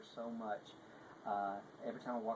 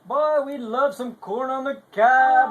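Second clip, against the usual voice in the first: much higher, much louder, slow teasing singsong; hammed-up clown boast; 23 dB louder, much slower than usual. Clown teasing a hungry hospital patient, loud slow mock boast. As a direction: lay it on thick, booming and drawn out to tease.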